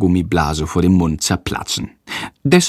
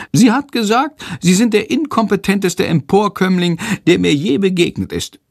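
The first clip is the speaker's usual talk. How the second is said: higher, quick creature voice; Shandor's voice; 10 semitones higher than usual. Acted. Shandor tells Jendrik school gossip, eager. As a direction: tell the gossip in the little creature's voice.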